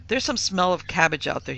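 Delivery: usual speaking voice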